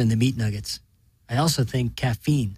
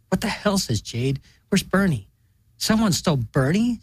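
higher, startled outcry; shocked disbelief; 7 semitones higher than usual. A narrator's reading. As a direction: react to the empty grave in alarm. Sisters find grave empty.

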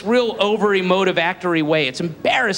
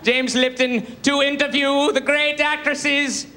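James Lipton impression; 5 semitones higher than usual. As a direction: savour every grand word of the speech. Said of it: "he's going".

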